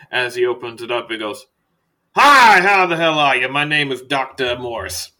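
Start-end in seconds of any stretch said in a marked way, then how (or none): 2.2-5.1 booming hello, easing on the name; loud greeting. Acted. Doctor introducing himself to a new patient, very loud. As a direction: burst out high and loud as the hearty doctor. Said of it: "You're quite loud".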